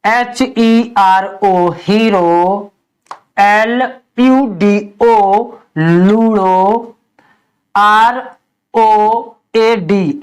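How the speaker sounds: letters spelled very slowly; slow spelling. Shown as a demonstration. Teacher spelling done letter by letter for pupils. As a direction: drag out each letter of the word. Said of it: "जैसे demo".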